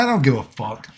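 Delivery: ordinary talk, nothing marked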